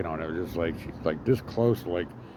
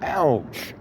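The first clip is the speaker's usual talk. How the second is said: high, louder mock ouch; 6 semitones higher, 4 dB louder than usual. After the punch through the window, a mock high ouch. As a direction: play up the sting. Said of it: "I'm like".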